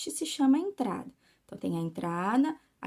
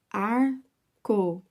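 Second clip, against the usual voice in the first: slow, split into separate syllables; much slower than usual. Shown as a demonstration. Teacher showing pupils how a word divides, syllable by syllable. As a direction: break "Arco-íris" into syllables with a pause after each. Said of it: "ele está separando essa palavra em sílabas".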